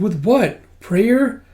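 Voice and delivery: high, rising disbelief; incredulous question. Acted. Mistakenly voiced character doubts the revival, incredulous. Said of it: "Oh, sorry, it's the scientist going".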